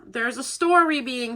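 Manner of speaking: ordinary talk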